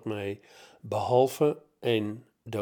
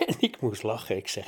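usual speaking voice

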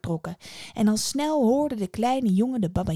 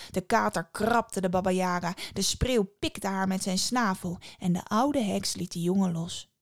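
ordinary delivery

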